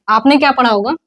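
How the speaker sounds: ordinary talk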